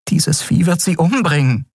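helpless, worried inner voice; desperate thought. A narrator's reading. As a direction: dread what is about to happen. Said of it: "dachte Miko verzweifelt".

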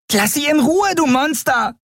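very high, tiny creature voice; small creature standing up to a monster; protesting little creature. A narrator's reading. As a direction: squeal it in indignant protest. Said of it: "protestierte das kleine Geschöpf".

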